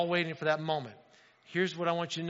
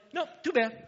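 mock refusal; 9 semitones higher than usual. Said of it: "I turn around and say".

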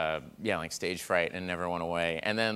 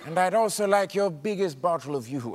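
James Mason impression; 4 semitones higher than usual. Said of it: "He's like".

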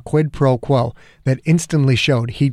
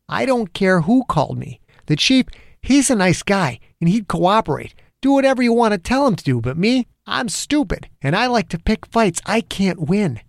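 building heated cop rant, pitched up; angry rant; 8 semitones higher than usual. A narrator's reading. Angry detective venting at the federal agent. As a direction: vent the whole bad day at him. Zimmer defiant.

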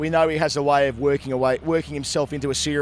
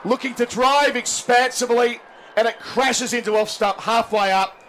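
cricket commentator; 7 semitones higher than usual. Acted. Live TV wicket commentary.

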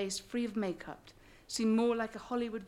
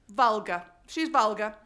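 curt verdict; 2 semitones higher, 9 dB louder than usual. Retold or imitated. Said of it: "I asked Miriam again".

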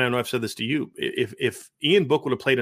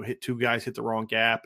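ordinary talk, nothing marked

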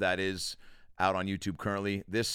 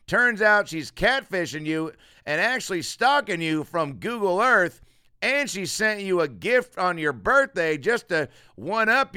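escalating Foxworthy parody; 7 semitones higher, 6 dB louder than usual. Retold or imitated. Podcaster spoofing the catchphrase comic, building into a high loud rant. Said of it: "you can really add that to anything".

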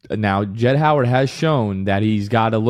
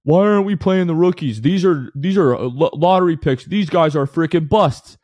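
mocking angry fans; 5 semitones higher than usual. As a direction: sneer it like a complaining fan. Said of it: "to the people out there that are saying".

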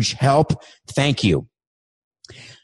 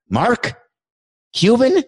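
surprised; 9 semitones higher, slower than usual. Acted. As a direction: pop the name up in disbelief. Host surprised at Mark Cuban.

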